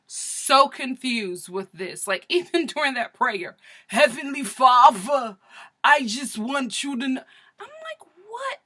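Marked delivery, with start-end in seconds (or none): 3.9-7.2 hammed-up New York accent; mock New York accent. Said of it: "this Baltimore accent, but she sounds like she from Flatbush". 7.6-8.6 very high, soft squeaky disbelief; shocked confusion; high incredulous squeak; baffled. Real, not acted. Reacting with disbelief.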